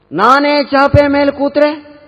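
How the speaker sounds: loud, high, slow drawn-out question; escalating mother-in-law. Acted. Mother won't stop testing the bride, loud insistent challenge.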